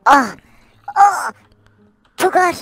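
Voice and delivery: mock strain. Lifting heavy object in game.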